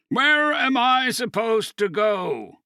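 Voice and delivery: slow, drawn-out furious yell, high and loud; angry shout. A narrator's reading. Dean yells at the trio who summoned him, slow furious shout. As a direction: hurl the question at them in fury.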